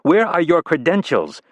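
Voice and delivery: a prim aide pressing the point; persistent staffer. Acted. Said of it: "the staffer persisted".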